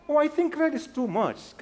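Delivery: high, slow mother voice; mock mother; imitating a mom. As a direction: gently overrule the kid, drawn out. Mother answers child.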